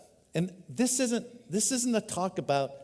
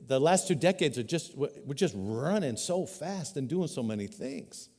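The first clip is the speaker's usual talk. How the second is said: ordinary delivery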